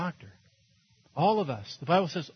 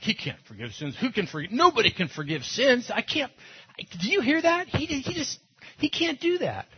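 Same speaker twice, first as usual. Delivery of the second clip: indignant Pharisees; 8 semitones higher than usual. Retold or imitated. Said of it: "Pharisees are like".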